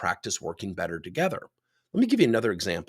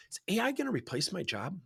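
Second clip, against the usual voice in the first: softer, higher employee voice; role-played employee question; 7 semitones higher, 7 dB quieter than usual. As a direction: ask the boss softly, pitched up. Role-played employee first asks boss.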